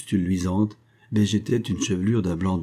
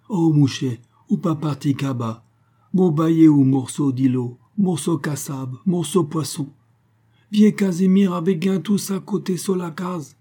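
put-on high, loud Creole old man, eagerly offering; 8 semitones higher, 4 dB louder than usual. A narrator's reading. Old Casimir begs the stranger to stay and eat, high eager Creole voice. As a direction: press your humble gifts on a guest, high and eager.

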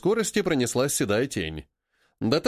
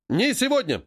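unison reply; 7 semitones higher than usual. A narrator's reading. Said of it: "звучит слаженный ответ".

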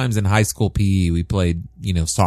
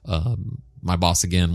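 normal talking voice

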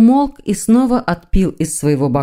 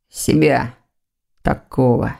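a low, heavy, bitter admission of hating himself; bitter self-loathing; 5 semitones lower, slower than usual. Acted. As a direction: spit out the self-contempt, slowly. Son's bitter self-loathing confession. (горькое, тяжёлое отвращение к себе)

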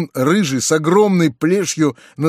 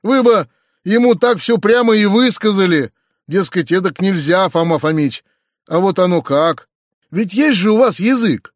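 raised, mildly heated challenge; heated cry; 5 semitones higher, 4 dB louder than usual. A narrator's reading. Nephew urges peasants to speak up, mildly heated. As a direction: exclaim it higher, a touch impatient. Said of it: "закричал я в запальчивости".

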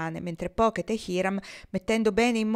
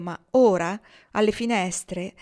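ordinary delivery, nothing marked

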